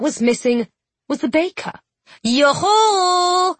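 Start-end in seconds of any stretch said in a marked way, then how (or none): 2.2-3.6 high, drawn-out singsong call. A narrator's reading. Children calling for the missing baker, calling out. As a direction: call out yoo-hoo as children hailing someone. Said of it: "the children called".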